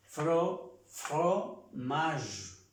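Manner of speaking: "fromage" broken after "Fro", ending on zh; silent final E. Shown as a demonstration. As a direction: build up "fromage" syllable by syllable, no final E. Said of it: "No se pronuncia la E".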